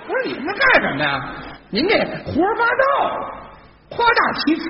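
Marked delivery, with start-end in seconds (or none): 1.7-4.6 indignant accusation. Acted. Straight man denounces partner's tall tale, high indignant accusation. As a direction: accuse him in high-pitched exasperation.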